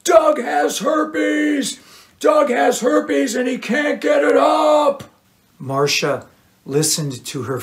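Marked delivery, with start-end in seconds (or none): 0.0-5.0 high, slow shouted announcement; vengeful shout. A narrator's reading. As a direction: broadcast it to the whole bar. Cheryl shouts into bar.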